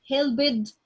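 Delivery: "helped" said as "hel-bid", with a voiced b and an extra syllable; teacher says "helped" the wrong way, "hel-bid"; wrong pronunciation. Shown as a demonstration. Teacher warning students off the wrong "hel-bid". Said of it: "No, this is not English".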